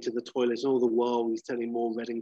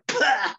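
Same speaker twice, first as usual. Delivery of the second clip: high loud mock splutter burst; explosive cough; 13 semitones higher, 7 dB louder than usual. Clark bursting on Sharon Stone, loud mock cough spray. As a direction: spit it all out in one bang. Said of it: "he just coughs like".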